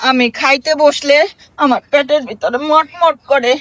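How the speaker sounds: low-pitched, subdued explaining; sheepish excuse. Acted. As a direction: own up in a low voice. Mother sheepishly explains herself to son.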